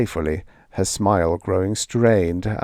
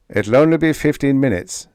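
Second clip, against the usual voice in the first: a louder, clipped rasp over the radio; a rasped, louder radio order; 6 semitones higher, 4 dB louder than usual. Acted. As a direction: rasp it like a cold machine voice. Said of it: "the panel rasped".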